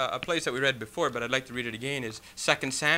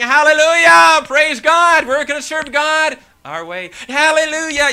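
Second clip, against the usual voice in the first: much higher, louder jubilant hallelujah cries; excited crowd; 12 semitones higher, 11 dB louder than usual. Retold or imitated. Preacher acting the crowd bringing the ark, exultant shouts. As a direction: belt it out like a joyful procession. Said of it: "they're all excited".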